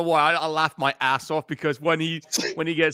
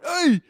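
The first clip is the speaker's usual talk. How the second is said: high, sharp "Hey!"; imitated yelp; 12 semitones higher, 3 dB louder than usual. Showing Jason's reaction when she runs off, high yelp. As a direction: mimic a hurt man's startled cry. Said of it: "You hear him going".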